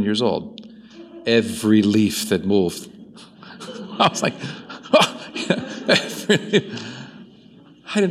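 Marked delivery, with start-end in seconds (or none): 3.5-8.1 laughing while retelling. Retold or imitated. Scared boy among moving leaves.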